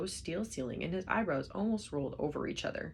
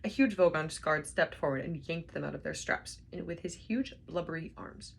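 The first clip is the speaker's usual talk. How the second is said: ordinary delivery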